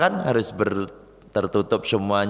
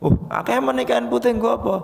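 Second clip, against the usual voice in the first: high, fast startled mother; puzzled mother; 12 semitones higher, faster than usual. Retold or imitated. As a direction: fire the question like a suspicious mother. (ibu heran, tinggi dan cepat)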